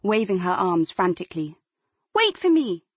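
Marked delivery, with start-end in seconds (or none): 2.1-2.8 high, loud desperate call. Acted. Girl calls to sister across the road, loud pleading shout. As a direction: shout it so she hears over the buses.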